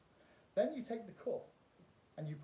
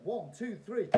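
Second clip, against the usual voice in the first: loud, high showman patter; kids party magician; 6 semitones higher, 8 dB louder than usual. Acted. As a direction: play it big for the crowd. Magician countdown.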